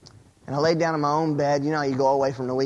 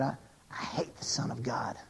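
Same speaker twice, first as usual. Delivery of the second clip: very soft, low whispered menace; evil, scratchy voice; 6 semitones lower, 12 dB quieter than usual. Acted. Satan in a dream declaring his hatred, evil scratchy whisper. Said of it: "evil, scratchy voice".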